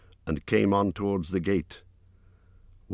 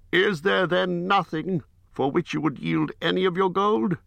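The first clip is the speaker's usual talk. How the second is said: Bard the bowman; 13 semitones higher than usual. A narrator's reading. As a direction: raise the voice high for a grave herald-like Bard. Bard questions Thorin.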